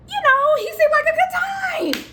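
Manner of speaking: high, loud party shout; excited outburst. Acted. Storyteller defending a fling to viewers, loud high excited shout. Justifying the fling, first take.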